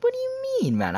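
very high, squeaky protest; high-pitched indignant question; mock disbelief. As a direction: imitate baffled innocence, voice shooting up. Retold protest at theft accusation.

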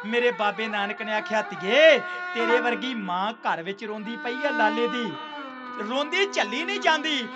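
loud, impassioned sermon voice; preaching at full force. Acted. Preacher voicing Guru Nanak to the congregation, loud and fervent. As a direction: thunder the story to the congregation.

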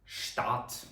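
штат said softly with sh at the start; German sht sound. Shown as a demonstration. Teacher models German reading for students: штат with sh. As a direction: breathe out штат, sh then t. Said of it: "читаємо як".

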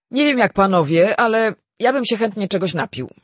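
high, loud, breezy voice; loud, airy character voice; brash young Marston. A narrator's reading. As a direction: pipe up carelessly, proposing a drink. Marston speaks up for a drink.

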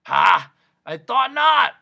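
loud, high raspy gloating; happy, raspy voice. A narrator's reading. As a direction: crow happily with a hoarse rasp. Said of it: "said the voice happily".

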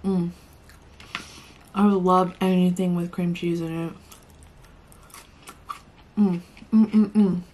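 talking while chewing sushi; muffled speech between bites; mouth full chewing. Real, not acted. Seen 2: Chews sushi throughout, takes another bite at 4.2s, cheeks full.

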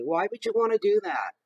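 normal talking voice

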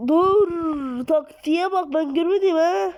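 high, loud, half-crying protest; excited outcry. Real, not acted. To the viewers about the enemy's trick, alarmed outcry. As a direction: yelp in alarmed disbelief.

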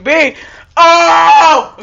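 loud high rushing yell; shouting. Real, not acted. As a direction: yell the callout then cry out. Gamer yells during rush.

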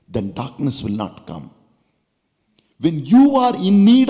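fervent preaching voice, voicing the Lord; preaching as God. Preacher speaking God's words to the congregation, fervent. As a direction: proclaim it like God speaking to his child.

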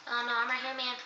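normal talking voice

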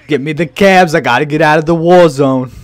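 loud guido bark; hammed-up Jersey Shore guy. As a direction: shout it like a party bro in a war.

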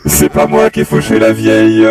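deadpan machine voice; robot voice. Pretend. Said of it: "tu fais la voix de robot".

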